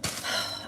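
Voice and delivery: soft exasperated sigh; breathy sigh of regret. Real, not acted. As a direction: let out a quiet, weary breath. Sighs over hating smoking.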